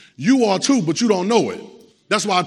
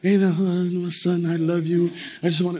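syrupy reassurance, sent up; mock nice talk. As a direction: imitate a sweet, coddling father. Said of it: "they don't understand nice talk".

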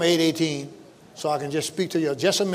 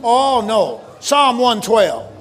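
high, loud, ringing preacher's call; preaching at full force; 6 semitones higher, 4 dB louder than usual. Real, not acted. Pastor rousing the congregation toward Psalm one twelve. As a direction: lift the church with every line.

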